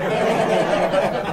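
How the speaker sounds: bright laugh after the punchline; laughing at the surprise ending; real laugh. Real, not acted. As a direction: burst into laughter. Laughs at birth certificate.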